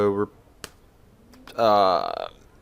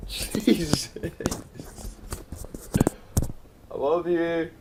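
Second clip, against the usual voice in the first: laughing off fear; 4 semitones higher, 4 dB louder, slower than usual. Real, not acted. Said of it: "Scared the shit out of me".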